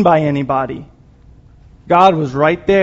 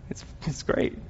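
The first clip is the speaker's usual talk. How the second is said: breathless whispered aside; a reverent whisper; 13 dB quieter than usual. Real, not acted. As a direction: hush it, almost to yourself. Awed aside after choosing right.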